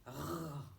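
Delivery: "r" said as the sound alone, not the letter name. Shown as a demonstration. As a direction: isolate the r sound. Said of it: "C'est le son".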